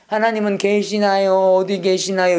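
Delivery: slow, droning plaintive questions; mock struggling prayer. Teacher mimicking people who pray wearily, mock laboured chant. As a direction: drag out a chanted, helpless plea. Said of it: "이렇게 힘들어요".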